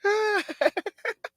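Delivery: high-pitched burst of laughter; big laugh. Real, not acted. Preacher laughing at his Pee Wee Herman bit, high laugh. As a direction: crack up at your own joke.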